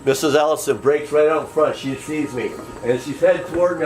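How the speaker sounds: normal talking voice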